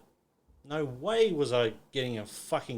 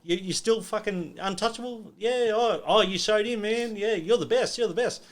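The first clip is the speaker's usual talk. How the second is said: high, loud imitation of taunting; mock jeering; 9 semitones higher, 5 dB louder than usual. Club boys rubbing in a young boxer's loss, jeering. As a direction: crow over him in a raised, sarcastic voice.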